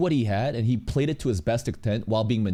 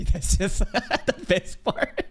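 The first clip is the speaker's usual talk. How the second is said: laughing; 5 semitones higher, much slower than usual. Real, not acted. Laughing at Pokemon drama.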